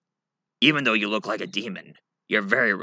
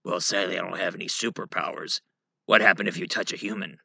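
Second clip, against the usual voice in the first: ordinary talk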